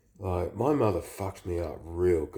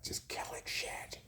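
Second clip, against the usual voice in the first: whisper; 12 dB quieter, slower than usual. Real, not acted. Whispered aside about gym.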